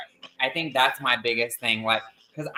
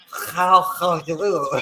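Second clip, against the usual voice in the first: imitating a queen's bad British accent, a touch louder; tossed-off British accent imitation, lightly done; mock British accent; 3 semitones higher, 4 dB louder, slower than usual. Quoting [name]'s [name], in a mock British accent. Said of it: "that's how she would talk".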